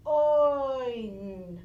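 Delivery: loud oi sound. Shown as a demonstration. Teacher calling the oi sound for kids. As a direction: belt out the oi sound. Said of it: "And J".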